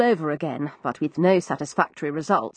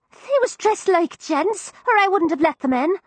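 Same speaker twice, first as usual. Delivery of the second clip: put-on higher, young cockney maid; frightened servant girl, higher cockney; Florrie's voice; 11 semitones higher than usual. Narrator voices East End maid.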